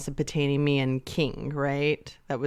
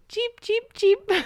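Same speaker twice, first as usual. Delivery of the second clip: high squeaky cheeps like a tiny bird; cartoon bird chirping; slower than usual. Imitating the daughter's bird music.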